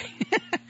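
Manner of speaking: soft breathy laugh. Real, not acted. Laugh after his joke.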